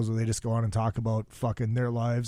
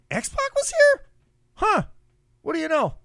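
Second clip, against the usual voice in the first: mock surprise; 4 dB louder than usual. Retold surprise at seeing wrestler.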